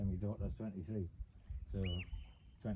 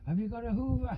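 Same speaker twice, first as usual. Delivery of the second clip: put-on kids asking; 12 semitones higher, 6 dB louder than usual. Kids calling out, retold.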